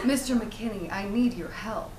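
low noir client plea; smoky noir. Shown as a demonstration. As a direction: plead it low, smoky film-noir style. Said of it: "let's hear the smoky noir".

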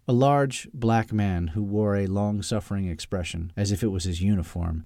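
ordinary delivery, nothing marked

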